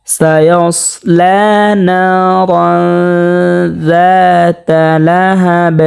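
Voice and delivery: slow recitation with a thick, full স in সায়াসলা; measured tajweed model, heavy স in সায়াসলা; heavy-letter recitation. Shown as a demonstration. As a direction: thicken the স of সায়াসলা and hold the long vowels. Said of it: "এই স্বরটাকে মোটা করে পড়তে হবে".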